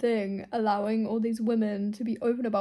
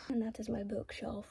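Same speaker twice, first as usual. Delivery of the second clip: soft unvoiced whisper; hushed late-night whisper; 11 dB quieter than usual. Real, not acted. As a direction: whisper it so nobody wakes. Wrapping up shelf tour, whispering.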